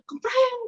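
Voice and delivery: high mock crash of a breaking plate; playful high kepreng of breaking china; crash sound. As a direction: mimic a plate smashing.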